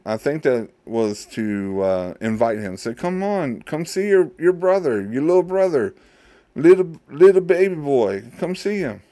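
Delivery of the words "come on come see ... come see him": coaxing father. Acted. Father coaxing his son.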